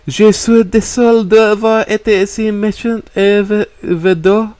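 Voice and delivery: slow, high, remorseful; tearful French apology. A narrator's reading. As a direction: plead sorry in French. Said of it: "overcome with emotions and remorse".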